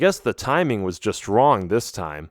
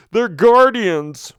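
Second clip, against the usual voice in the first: high, loud, slow warning shout; 10 semitones higher, 7 dB louder, much slower than usual. Acted. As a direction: yell the alarm out. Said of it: "one of the bats shouted".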